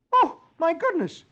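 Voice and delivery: high, loud startled cry; exclaiming. Acted. Dagwood realises it's Sunday, high startled cry. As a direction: yelp in shock. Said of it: "He exclaims".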